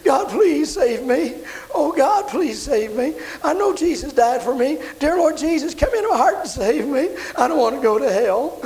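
high, pleading mock sobs; retold weeping prayer. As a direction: imitate a desperate tearful prayer. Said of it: "she began to weep".

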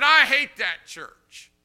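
high, loud and slow; slow furious shout. Retold or imitated. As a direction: spit each word in rage. Drunk father raging.

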